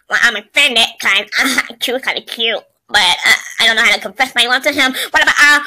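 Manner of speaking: low put-on voice, hesitant; awkward confession. Unsure how to confess love, awkward low voice. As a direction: pitch it well down and stumble along.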